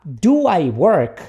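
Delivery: slow, deliberate question; repeat-after-me model. Shown as a demonstration. Teacher models the question for students, slowly. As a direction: space out the short question for learners. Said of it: "Então, vamos lá".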